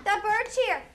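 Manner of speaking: high, bright call to the whole room; rally the class with cheers and orders; classroom teacher voice. Real, not acted. Teacher addressing class in raised voice.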